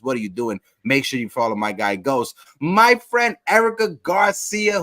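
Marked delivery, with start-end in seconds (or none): 2.6-4.8 higher, louder call-out; excited shout-out. Real, not acted. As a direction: hype her name up. Birthday shout-out.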